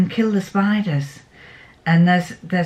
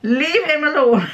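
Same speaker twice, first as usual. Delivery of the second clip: firm mother; 8 semitones higher, 3 dB louder than usual. Retold or imitated. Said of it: "and I said".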